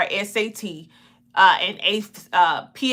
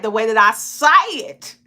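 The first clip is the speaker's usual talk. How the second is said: louder Southern twang, "say it" run together; country twang; 5 dB louder than usual. Shown as a demonstration. Host contrasting their own speech with correct English, country twang. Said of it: "But I was like".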